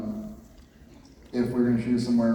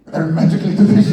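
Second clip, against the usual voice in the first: very loud, raised fake Scottish accent; hammed-up shouted Scottish accent; mock Scottish accent; 4 semitones higher, 12 dB louder than usual. Shown as a demonstration. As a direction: belt it out in a joke Scottish accent. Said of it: "uh, Scottish".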